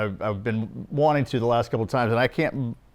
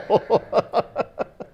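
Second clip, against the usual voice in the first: breathy laugh; 9 semitones higher than usual. Real, not acted. Laughing at steakhouse joke.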